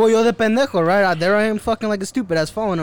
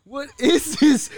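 slow, higher-pitched mock bewilderment; acting out stunned disbelief; retold bewildered question; 6 semitones higher, much slower than usual. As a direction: draw the question out high, imitating a baffled friend. Retelling friend's reaction to food.

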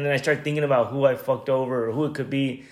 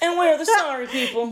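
high, haughty mock-royal voice; mock snob; 12 semitones higher than usual. Cohost mocking the rude old man, haughty high voice. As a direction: lightly sneer it like a pompous king.

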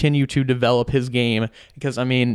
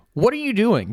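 much higher, incredulous what are you doing; signature catchphrase; 9 semitones higher than usual. Retold or imitated. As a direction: fling it out in disbelief. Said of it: "introduce this one".

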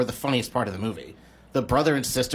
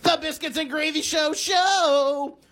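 high, drawn-out announcer call; show announcer; 13 semitones higher, slower than usual. Pretend. Host kicking off the show's segments, hammy announcer. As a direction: belt out the show title like a ringmaster.

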